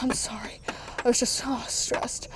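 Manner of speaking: soft unvoiced sorry; tired whisper of regret; whispered apology. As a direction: breathe the apology out. Toy murmurs apology.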